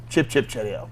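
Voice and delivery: a quiet, hammed-up English toff accent; a lightly voiced British accent, played for a joke; mock British accent. Host joking after a Monty Python item, in a British accent. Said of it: "That's a terrible accent".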